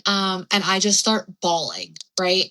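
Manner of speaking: ordinary talk, nothing marked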